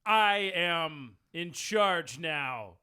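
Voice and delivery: drawn out, loud and commanding; slow commanding. Acted. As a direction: lay down the law slowly. Acting dominatrix to orc.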